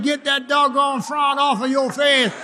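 high, forceful preacher's exhortation; fervent preaching. Real, not acted. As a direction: order the congregation to laugh. Exhorts congregation to laugh.

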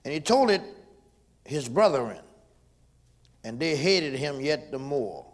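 loud, slow preaching cadence. Acted. Preacher reading scripture to his congregation, loud and slow. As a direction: preach the verse out to the church. Preaching Genesis at full force.

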